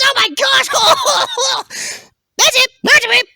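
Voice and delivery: very high, animated cartoon voice; excitable talking stool. Stool telling how it gets sat on, high excitable voice. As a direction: act out a hapless squeaky-high stool.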